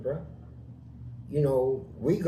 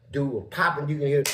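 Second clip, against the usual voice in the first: ordinary talk, nothing marked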